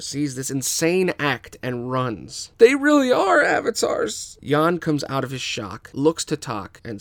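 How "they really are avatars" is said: high, loud amazement; stunned firebender realizing; awed. Acted. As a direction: gasp it in disbelief. Awed bystander.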